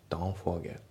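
"Don't forget" with the T of Don't silent; dropped T. Shown as a demonstration. Teacher showing learners how Don't loses its T, dropped T. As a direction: run "Don't" straight into "forget" without its T. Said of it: "don'tのTが抜けますね".

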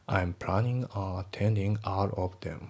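slow, careful English example; measured textbook read-aloud; slow model reading. Shown as a demonstration. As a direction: read each word slowly and clearly.

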